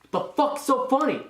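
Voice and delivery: high, loud, imitated demand; angry drill instructor. Drill instructor confronting a recruit who is laughing, angry.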